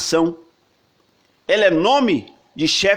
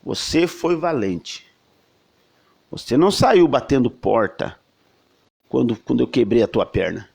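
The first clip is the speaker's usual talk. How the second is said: angel's blessing; 5 semitones lower than usual. Retold or imitated. Preacher voicing the angel renaming Jacob, low and solemn. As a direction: lower the voice and bless him gravely. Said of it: "diz assim".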